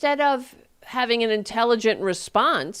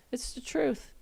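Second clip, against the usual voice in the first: softly whispered aside; 4 semitones higher, 9 dB quieter than usual. Real, not acted. Host whispers a final word to viewers after the rant. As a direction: breathe it out as a secret.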